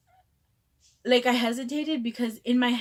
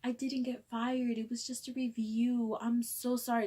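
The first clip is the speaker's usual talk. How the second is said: soft, drawn-out, higher whimper; retold near-sobbing apology; 4 semitones higher, 4 dB quieter, slower than usual. Prankster taking it back to coworker, near tears. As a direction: apologize as if about to cry. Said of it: "I literally almost started sobbing because I got way too in character".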